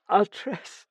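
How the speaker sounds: high, loud tearful burst; crying. A narrator's reading. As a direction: blurt it out through tears. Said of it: "She was crying now".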